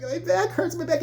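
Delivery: high-pitched mock whine; hurting young dancer. Girl complaining to coach while dancing, high mock whine. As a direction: pitch it up, half laughing, as a pained kid. Voicing the girl crying about pain.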